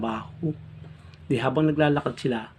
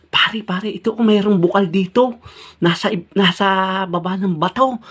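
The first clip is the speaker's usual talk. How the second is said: excited find; 7 semitones higher, 4 dB louder than usual. Acted. Mangyan excitedly finds spring.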